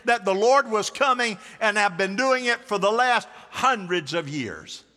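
loud, high-pitched sermon delivery; preaching at full force. Real, not acted.

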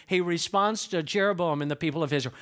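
ordinary talk

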